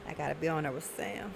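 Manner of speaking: quiet, turned away from the mic; said off mic, hard to hear. Real, not acted. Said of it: "Speak into the mic".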